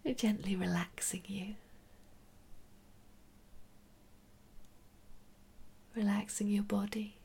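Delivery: soft whispered meditation guidance; right in your ear. Acted.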